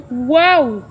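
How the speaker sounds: sharp gasp, then a loud wow; amazed gasp and wow. Real, not acted. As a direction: gasp in wonder at the photo.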